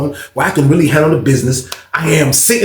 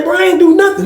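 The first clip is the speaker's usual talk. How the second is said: higher, louder protest of innocence; 3 dB louder than usual. Retold or imitated. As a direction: insist you did nothing wrong. Denying accusation.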